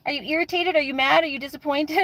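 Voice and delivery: lightly mocking, repetitive questioning; mock pestering questions. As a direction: imitate the chat badgering her over and over. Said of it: "someone keeps going".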